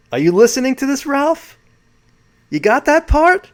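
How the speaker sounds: high, loud New York questioning; New York accent. Retold or imitated. Host quoting Sheehan checking on Ralph, high New York accent. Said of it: "That's my New York accent".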